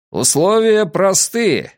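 high, drawn-out call to a crowd; shouted announcement. A narrator's reading. Vuko addresses the crowd from a gallery, shouting. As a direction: call it out slowly from a height. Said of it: "кричал Вуко с деревянной галереей".

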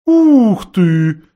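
loud, high surprised hoot; owl's exclamation. A narrator's reading. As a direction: gasp it like a startled owl. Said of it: "воскликнула сова".

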